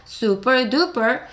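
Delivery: super duper drawn out slowly; teacher modelling slowly; slow model phrase. Shown as a demonstration. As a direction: stretch out super duper evenly. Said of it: "시작".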